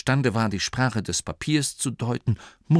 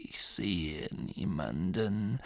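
put-on slow, soft, dismissive old voice; calm old abbot; 7 dB quieter, slower than usual. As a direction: say it calmly, barely bothering. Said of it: "behauptete der Abt ruhig".

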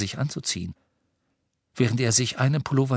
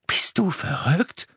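higher, slow, out of breath; breathless gasp; 7 semitones higher, 3 dB louder than usual. A narrator's reading. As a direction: gasp it out after the icy dive. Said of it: "eine keuchende Stimme". (keuchend, atemlos)

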